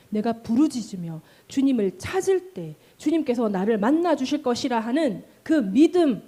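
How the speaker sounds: ordinary talk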